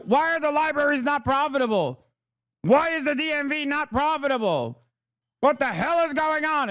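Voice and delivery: high, squeaky mock outrage at unprofitable libraries; mock outraged critic. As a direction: play a whiny critic baffled by libraries. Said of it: "we're like".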